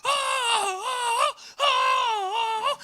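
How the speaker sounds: very high, loud hooting oh's; balcony yell. Retold or imitated. Man in the balcony calling out, very high loud hoots. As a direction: holler from the back balcony. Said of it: "qui crie".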